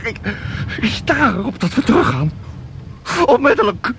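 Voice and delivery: frightened, stumbling protest; panicked insistence. Acted. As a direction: demand to go back, close to panic. Visitor insists on returning.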